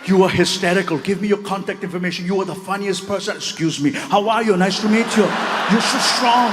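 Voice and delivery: put-on effusive Austrian-accented praise turning to greetings; Arnold Schwarzenegger. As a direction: gush in an Austrian accent, then move briskly on. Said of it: "he grabbed it".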